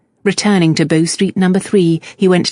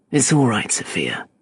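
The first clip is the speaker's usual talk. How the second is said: murmured; 6 semitones lower, 5 dB quieter than usual. A narrator's reading. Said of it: "Gentry murmured".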